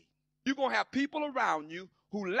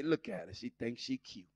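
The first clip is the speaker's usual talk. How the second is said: much lower, soft snide mutter; catty sneer; 9 semitones lower, 6 dB quieter than usual. Retold or imitated. Jealous churchgoer sneering at a dressed-up sister, low and snide. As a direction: sneer at her new dress. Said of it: "first thing they wanna say".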